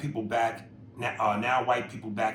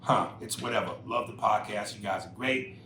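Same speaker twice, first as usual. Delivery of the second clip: ordinary talk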